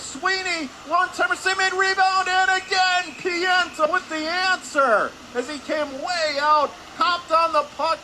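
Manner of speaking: loud, high-pitched hockey call at peak excitement; thrilled play-by-play. Real, not acted. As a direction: build to a soaring shout as the saves pile up, then ease slightly. Calling a flurry of saves.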